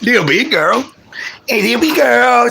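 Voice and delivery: high pet call; doggy talk. Real, not acted. Calling his dog.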